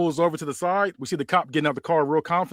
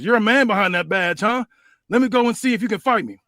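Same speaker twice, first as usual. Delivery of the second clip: higher, louder tough-guy challenge; Stone Cold taunt; 7 semitones higher, 5 dB louder than usual. Acted. Stone Cold challenging the cop to a fight, loud and cocky. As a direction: dare him to fight.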